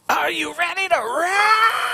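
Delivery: high, slow cartoon shout; vintage cartoon voice. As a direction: belt it like an old cartoon character. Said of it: "it comes out in, like, a cartoon voice".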